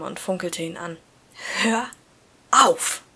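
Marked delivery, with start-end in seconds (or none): 1.6-3.0 sharp hiss of stop it. A narrator's reading. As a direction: hiss it through the teeth. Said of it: "zischte ich".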